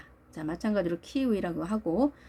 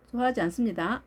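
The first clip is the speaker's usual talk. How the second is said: usual speaking voice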